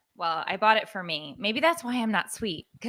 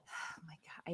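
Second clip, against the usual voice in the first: whisper; 6 semitones lower, 25 dB quieter than usual. Real, not acted. Embarrassed aside on podcast.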